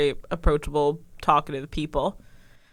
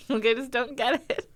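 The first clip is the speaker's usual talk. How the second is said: usual speaking voice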